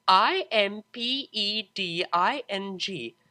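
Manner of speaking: slow, deliberate letter by letter; spelled out slowly. Acted. Octavia spelling the word for the Pegasus, slow and impatient. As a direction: spell each letter with strained patience.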